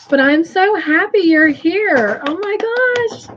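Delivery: bursting with gladness; happy outburst. Real, not acted. Host welcoming grieving friend Tasha with sudden delight.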